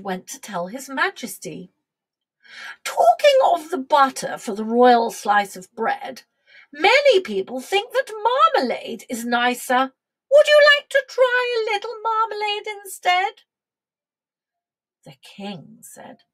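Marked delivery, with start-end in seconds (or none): 2.8-13.4 coaxing queen. Acted. Queen suggests marmalade.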